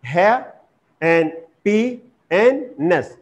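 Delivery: "ha and p and ness" in slow separate pieces; syllable by syllable. Shown as a demonstration. Teacher splitting happiness for learners, syllable by syllable. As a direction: break it up: "ha", then "p", then "ness". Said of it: "three syllable word".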